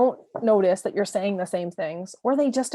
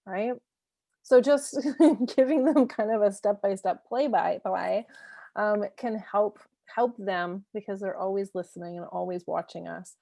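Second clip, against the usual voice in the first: ordinary talk, nothing marked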